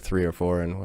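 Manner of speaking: usual speaking voice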